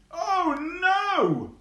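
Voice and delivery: very high, loud cry of dismay; shocked gasp. Acted. Minna sees the injured tortoise.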